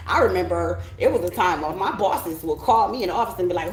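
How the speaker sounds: ordinary talk, nothing marked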